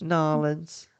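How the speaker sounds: put-on high, drawn-out Southern accent. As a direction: stretch New Orleans in a hammed-up Southern accent. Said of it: "Can you do that again".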